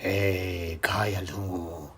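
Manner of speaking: very low, soft, slow menace; deep, hushed dramatic line; tough film hero. Acted. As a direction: growl a hero's threat in your sleep. Sleeper quotes a film hero menacingly.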